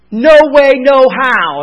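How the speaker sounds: NO WAY punched loud; loud emphatic denial. Shown as a demonstration. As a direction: slam "No way, no how" home hard. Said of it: "is what that means".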